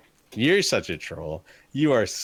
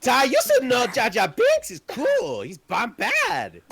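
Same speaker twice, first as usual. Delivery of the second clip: high, slow silly alien voice; squeaky cartoon sidekick voice; Jar Jar voice; 4 dB louder, slower than usual. Mocking Jar Jar voice.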